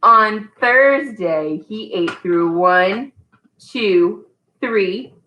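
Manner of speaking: slow, drawn-out storybook reading; patient read-aloud for a toddler; reading to a child. Acted. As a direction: leave pauses for the child to fill in. Reads The Very Hungry Caterpillar.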